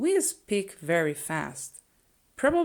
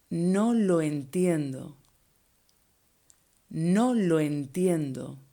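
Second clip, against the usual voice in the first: slow careful model; slower than usual. Shown as a demonstration. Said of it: "you say".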